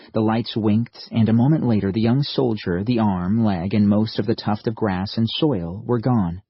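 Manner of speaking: plain reading voice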